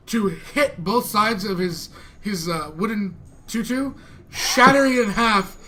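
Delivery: normal talking voice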